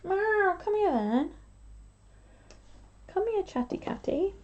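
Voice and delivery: high sing-song pet talk; cooing to a cat. Real, not acted. Calling the cat over.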